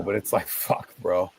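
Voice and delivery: normal talking voice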